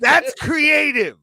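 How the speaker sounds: loud high shout. Real, not acted. Shouted reaction to chat.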